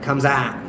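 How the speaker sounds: "comes out" as "comes aht", T swallowed; Cockney vowel. Shown as a demonstration. Londoner showing East London slipping in, "out" said as "aht". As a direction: flatten OUT to a long "aah" with no final T. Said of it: "sometimes it comes out".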